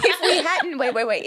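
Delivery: laughing as she cuts in; excited laughing. Real, not acted. Excited interruption while laughing.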